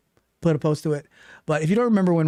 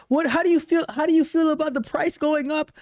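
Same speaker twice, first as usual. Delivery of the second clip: high-pitched mock sympathy; teasing mock concern; 12 semitones higher than usual. Host needling viewer about price rise, mock sympathy. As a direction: tease in a high babying voice.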